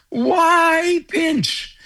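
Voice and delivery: high, drawn-out coach's yell, imitated; Pat Burns. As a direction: mimic a big-voiced coach calling out. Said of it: "avec sa grosse voix, criait tout le temps". (imitation d'un coach qui crie)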